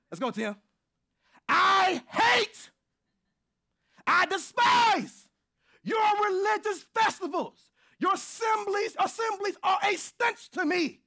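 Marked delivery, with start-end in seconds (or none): 1.5-11.0 high, loud thundering shout as God's voice; booming prophetic shout; preaching at full force. Acted. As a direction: roar God's anger at the people. Preacher voicing God in Amos.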